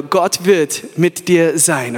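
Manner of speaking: very slow, low "Gott wird mit dir sein"; slow, low to neighbour. Shown as a demonstration. Preacher models the promise for the crowd, very slow and low. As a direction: stretch every word, low and calm. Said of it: "sag deinem Nachbar".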